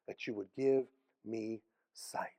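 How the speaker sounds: slow, whispered begging; a blind man whispering his plea to Jesus. Retold or imitated. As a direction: breathe the wish out slowly. Blind man's quiet request.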